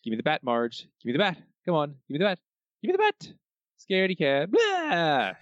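lightly voiced Homer, building to a high taunt; hammed-up Homer menace. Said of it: "Homer chasing Marge up some stairs".

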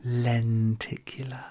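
breathy whispered read, slow. Acted. Savouring a favourite word, whispered. Reading a listener's email aloud.

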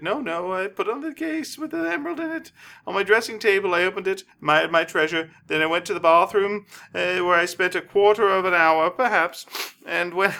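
mock soprano telling of the theft; opera diva. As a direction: squeal it like an upset prima donna. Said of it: "Signora Castafiore, and is going".

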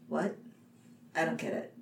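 hushed, puzzled crowd reaction; baffled murmur. Retold or imitated. Crowd on opening night, quietly baffled. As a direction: say it under your breath, lost.

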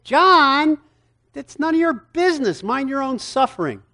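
high, loud, curt put-down; sharp rebuke. Acted. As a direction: snap back at a nosy question. Jesus rebuking Peter about John.

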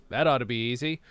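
usual speaking voice

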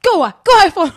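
high, loud playful shout; mock yell. Owner shooing her cat, high and loud mock yell. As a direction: shoo the cat away loudly. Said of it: "jag bara".

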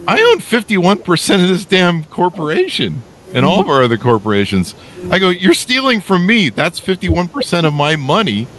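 high, heated retold telling-off; indignant scolding. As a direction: lecture him high and indignant. CEO scolds the stealing employee.

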